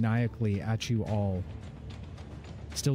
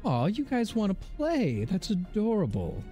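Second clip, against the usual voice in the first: high, patronizing coo; mocking baby talk; 9 semitones higher than usual. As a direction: coo at them like children. GM voices the female figure.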